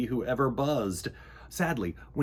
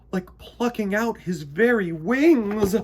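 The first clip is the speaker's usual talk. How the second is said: dramatic dread; 7 semitones higher, 8 dB louder, slower than usual. A narrator's reading. Climax of nightmare.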